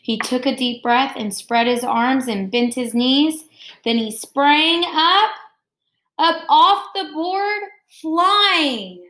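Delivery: expressive picture-book read-aloud; reading to children. Acted. Teacher reading Jabari Jumps to her class, expressive read-aloud. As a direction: read the story warmly to the class, pausing on each step.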